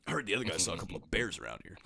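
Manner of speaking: low, soft, quick canned line; game voice line. Retold or imitated. Actor talking in game, heard as a low voice line. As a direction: imitate a recorded character callout, low and quiet. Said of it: "like an F one voice line".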